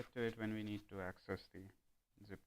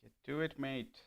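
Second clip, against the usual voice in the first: louder, higher, mock Aussie accent; Australian accent; 4 semitones higher, 6 dB louder than usual. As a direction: slip into a playful Australian accent. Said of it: "I don't know why I'm Aus-, Australian".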